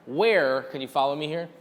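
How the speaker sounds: usual speaking voice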